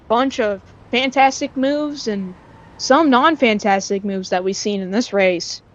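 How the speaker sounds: ordinary talk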